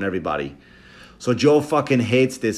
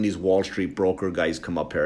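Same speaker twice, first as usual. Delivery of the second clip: ordinary delivery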